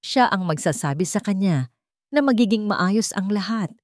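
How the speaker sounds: usual speaking voice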